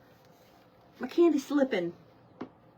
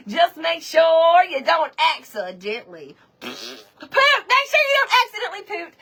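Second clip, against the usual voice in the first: loud teasing; 5 semitones higher, 4 dB louder than usual. Real, not acted.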